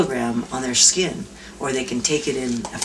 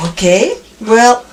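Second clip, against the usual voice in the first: louder, higher and slow, unsure; hesitant okay; 6 semitones higher, 7 dB louder, slower than usual. Retold or imitated. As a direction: drag it out doubtfully. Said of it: "And Steve goes".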